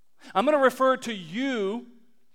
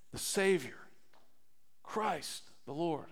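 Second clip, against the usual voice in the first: much softer, lower and hushed; hushed reverence; 6 semitones lower, 16 dB quieter than usual. Real, not acted. Preacher naming Christ to the congregation, hushed and reverent. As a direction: drop to a reverent near-whisper.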